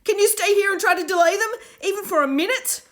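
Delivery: high, loud urgent plea; urgent call. A narrator's reading. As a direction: shout the request in a hurry. Will pleading urgently.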